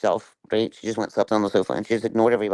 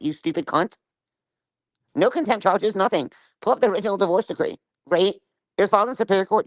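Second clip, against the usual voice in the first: higher, heated insults in a furious rant; angry rant; 6 semitones higher than usual. Real, not acted. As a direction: tear into her, voice pitched up. Ranting at Flavor, insulting her.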